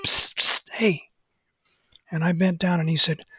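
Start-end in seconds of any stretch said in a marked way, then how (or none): 0.0-1.1 hushed psst to get attention. Retold or imitated. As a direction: hiss psst from out of sight. Hidden man calls for attention.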